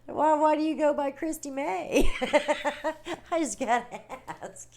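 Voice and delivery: laughing while asking; giggling through the question. Real, not acted. Laughing over a funny name.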